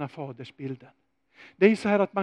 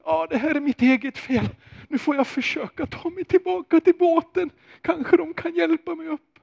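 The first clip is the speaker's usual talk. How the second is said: much higher, strained self-reproach; self-blaming Peter; 12 semitones higher, 5 dB louder than usual. Acted. Peter sinking, blaming himself in a much higher voice. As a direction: think it as a guilty man scrambling back. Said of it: "tänka fördömelsens tankar".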